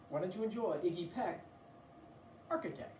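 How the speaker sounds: ordinary delivery, nothing marked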